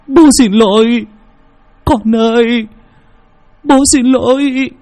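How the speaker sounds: remorseful father, building from subdued to high wail; tearful apology. A narrator's reading. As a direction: start contained, climb into anguished high pleading. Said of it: "Đức trào nước mắt".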